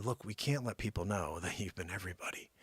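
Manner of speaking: hushed, secretive whisper; low, very soft scheming aside; conspiratorial whisper. Acted. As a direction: whisper it like a cover-up plot. Conspirators secretly plotting, acted.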